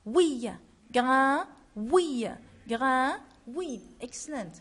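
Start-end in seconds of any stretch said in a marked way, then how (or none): 0.9-3.2 "grain" called out loud with a rising end; loud model word. Shown as a demonstration. Teacher drilling the class, loud and clear. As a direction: call "grain" for the class to copy. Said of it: "on dit grain".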